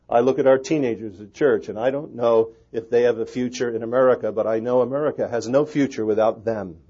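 loud, urgent pulpit delivery; full-force preaching. Real, not acted. Pastor urging his congregation about teenagers, loud preaching. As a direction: preach it out over the crowd.

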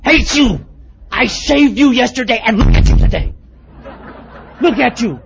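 loud, high hammed-up yell at his own reflection; mock furious yell at himself in the mirror; mock shout at mirror. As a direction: bellow at the mirror in mock fury.

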